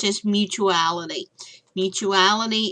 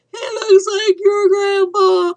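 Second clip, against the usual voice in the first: high, loud wailing cry; dismayed outcry; 13 semitones higher, 9 dB louder, slower than usual. Retold or imitated. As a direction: shriek it out in mock despair. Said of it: "I was like".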